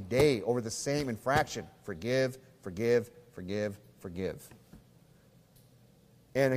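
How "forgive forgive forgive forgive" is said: fading repetition. Real, not acted. Repeated word fading out.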